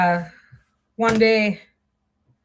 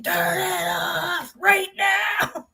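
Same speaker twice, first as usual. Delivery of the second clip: high, slow, drawn-out yell of alarm; retold scream; 7 semitones higher, much slower than usual. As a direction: yell it out to stop the machine. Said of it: "we just started screaming".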